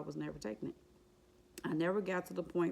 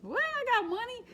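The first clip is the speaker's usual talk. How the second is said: much higher and louder, squeaking in disbelief; shocked disbelief; 6 dB louder than usual. Retold or imitated. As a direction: squeal it like you can't believe the card failed. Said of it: "I'm just like".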